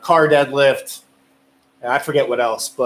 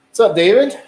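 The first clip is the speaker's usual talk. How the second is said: higher, louder hello to a viewer; cheery greeting; 6 semitones higher, 5 dB louder than usual. Real, not acted. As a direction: call out a friendly welcome.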